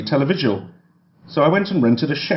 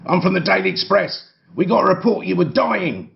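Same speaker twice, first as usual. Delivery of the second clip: higher-pitched tabloid snapper; protesting doorstep photographer, pitched up; rat man; 7 semitones higher than usual. A narrator's reading. Photographer from the Daily Express justifying himself, high protesting voice. Photographer shouts back his excuse.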